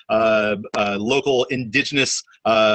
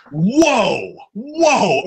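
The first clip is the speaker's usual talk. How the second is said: rising, louder whoas, the second much higher; stunned whoa; 10 semitones higher, 4 dB louder than usual. Retold or imitated. Musician retells hearing he is worth it, rising amazed whoa. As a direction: let the surprise climb on each whoa. Reacting to being called worth it.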